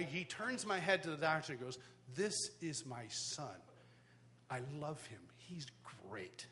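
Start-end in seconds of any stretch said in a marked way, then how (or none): 2.1-6.5 slow, hushed, heartfelt declaration; tender pride. Retold or imitated. Dad presenting his son to the doctor, slow and tender. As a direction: savor each word softly.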